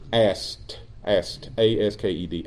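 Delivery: ASKED said low and quiet, ending fully sounded; soft, low correction of ASKED; careful self-correction. Real, not acted. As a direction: quietly enunciate ASKED, then spell it out. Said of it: "I said it so fast".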